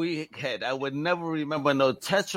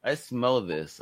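ordinary delivery

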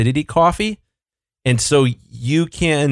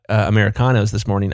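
ordinary talk, nothing marked

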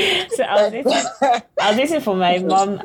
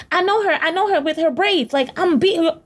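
high-pitched imitation of a ranter; mock ranting; 9 semitones higher than usual. Friend imitating the stranger who threatened her, high mock rant. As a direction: mimic a wild threat, pitched right up.

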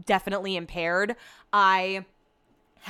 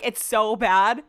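ordinary delivery

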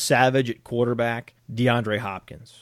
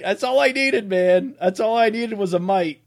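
mock exasperation; 7 semitones higher, 3 dB louder than usual. Mocks co-host's weak hedge.

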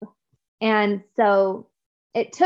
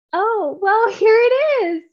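laughing surprise; 11 semitones higher, 5 dB louder, slower than usual. Retold or imitated. Surprised by leader's tears.

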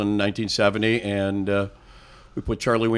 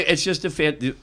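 normal talking voice

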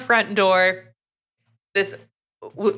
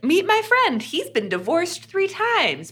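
high mock voice; 10 semitones higher than usual. Retelling a drunk friend's quote.

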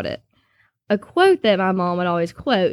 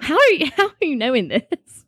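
amazed laughing question; 7 semitones higher than usual. Retold or imitated. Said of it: "And I was like".

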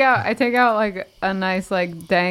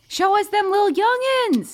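eager; 8 semitones higher than usual. Acted. Eagerly asking after the family.

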